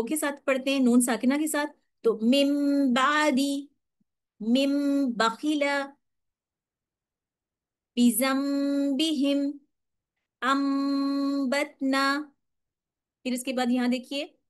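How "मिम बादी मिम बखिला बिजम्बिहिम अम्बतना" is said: very slow, drawn-out recitation with nasal m; slow, chant-like reading with ghunna; slow Quranic recitation. Shown as a demonstration. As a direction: recite each word slowly for students to follow. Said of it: "मेरे साथ पढ़िए".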